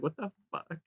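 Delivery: hushed, mock-baffled reaction; baffled foreign listeners. Imagining foreign listeners' reaction, softly mock-baffled. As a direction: drop the volume, imitating stunned listeners. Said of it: "our foreign listeners are like".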